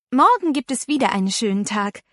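ordinary delivery, nothing marked

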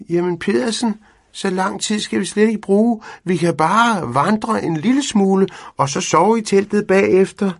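high, loud, wheedling cat voice; persuading cat. A narrator's reading. Cat coaxing Pedersen into a short hike, high and pleading. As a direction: talk the old man round like an eager cat.

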